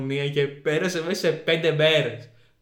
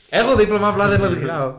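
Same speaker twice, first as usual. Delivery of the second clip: loud, raised-pitch protest; hammed-up indignant yell; mock yelling; 5 semitones higher, 8 dB louder than usual. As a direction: shout it as an exasperated comeback. Acts yelling back at window washers.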